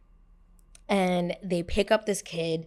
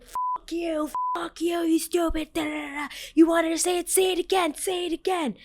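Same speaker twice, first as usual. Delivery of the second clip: angry attacker; 10 semitones higher than usual. Retold or imitated. Attacker holding her underwater, in high-pitched imitated rage. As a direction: imitate a raging attacker in a much higher voice.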